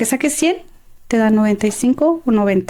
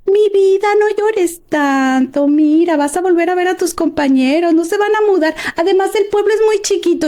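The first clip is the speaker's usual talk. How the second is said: put-on consoling older lady; 7 semitones higher than usual. Lady consoles a crying girl at graduation mass, cooing imitation. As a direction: imitate a neighbour soothing a crying girl in a higher voice. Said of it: "se acercó una señora y me dijo".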